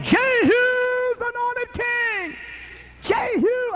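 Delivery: high, slow, drawn-out acclaim; crowd of men screaming. Acted. Men running behind Jehu, screaming acclaim. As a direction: hail the new king with a long cry. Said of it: "a bunch of men screaming behind him".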